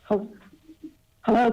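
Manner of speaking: ordinary talk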